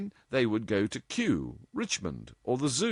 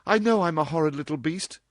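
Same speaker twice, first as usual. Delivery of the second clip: fast, louder, higher outburst; cried out; 8 semitones higher, 5 dB louder, faster than usual. A narrator's reading. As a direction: blurt it out quickly. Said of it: "she cried".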